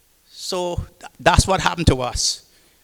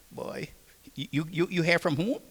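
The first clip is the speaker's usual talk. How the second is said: softer, hushed asking; hushed rumor; 7 dB quieter than usual. Retold or imitated. Shipmate quietly asking about letters, hushed. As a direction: pass the rumor along quietly. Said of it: "whisper on the ship".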